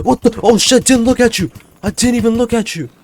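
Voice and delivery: very high, loud mock screech; yelling. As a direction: shriek it in hammed-up protest. Acts out yelling at teacher.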